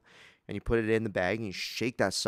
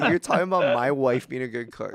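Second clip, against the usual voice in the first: louder indignant retort; mock indignation; 5 semitones higher, 9 dB louder than usual. Son answers his dad, in mock indignation. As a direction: call him out, half joking. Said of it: "And I'm like".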